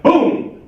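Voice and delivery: sudden high shouted boom. Acted. Comic telling of a kicked box, sudden boom. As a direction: bark out the boom sound. Kicking the gift box open.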